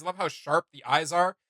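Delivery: usual speaking voice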